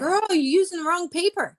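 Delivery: high, loud, knowing put-down; sassy correction. Retold or imitated. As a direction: tell her off like a best friend. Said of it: "and you're like".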